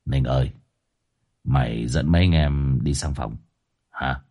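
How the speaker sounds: low, soft boss giving an order; boss calling Ninh. Acted. Boss orders Ninh to show the rooms, low and soft. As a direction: jerk the chin and quietly order him. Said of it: "hất hất hàm".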